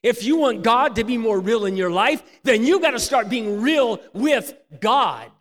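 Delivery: loud, high preaching, slowing at the end; preaching at full force. Acted. Preacher urging the congregation, loud and high. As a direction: hammer the point home to the crowd. Preacher drives point home.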